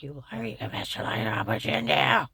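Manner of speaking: imitating an accuser's low growl; imitating angry accuser. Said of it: "You talk about a sick son of a bitch".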